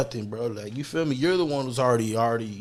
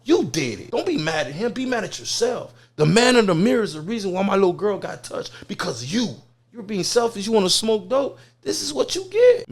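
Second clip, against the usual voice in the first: tough scolding; 7 semitones higher than usual. Acted. Acted confrontation scolding the man.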